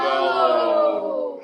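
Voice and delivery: booming giant shout, low and loud. Shown as a demonstration. Teacher leads the class in a booming giant voice. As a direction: stomp it out like a giant. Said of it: "let's say the word in a giant voice".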